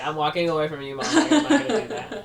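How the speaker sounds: laughing protest. Retold or imitated. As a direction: giggle out a brush-off. Daughter laughing off mom's nagging.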